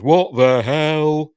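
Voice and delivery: imitating a freaked-out Donna; freaked-out cry. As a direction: stretch out "What the hell?" like you've just had a fright. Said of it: "she freaks out".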